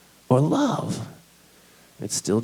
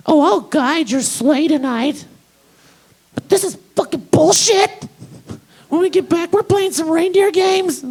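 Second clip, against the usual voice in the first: much higher, louder cartoon reindeer; Rudolph voice; 4 dB louder than usual.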